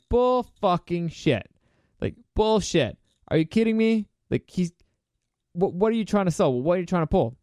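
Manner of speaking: imitating a scornful queen calling bullshit; incredulous outburst. Cersei scoffs at Littlefinger's dragon glass story, incredulous and mocking.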